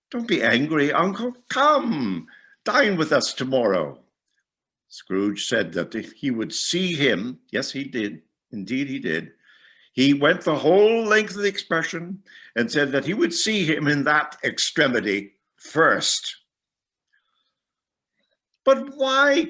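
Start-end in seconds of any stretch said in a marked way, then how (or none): 0.1-4.0 higher, coaxing nephew; nephew's voice. A narrator's reading. 18.6-19.5 much higher, louder plea; nephew's cry. A narrator's reading. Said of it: "cried Scrooge's nephew".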